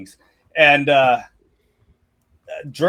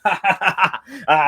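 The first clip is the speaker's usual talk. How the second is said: laughing it off; 4 semitones higher than usual. Acted. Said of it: "he kinda laughs it off".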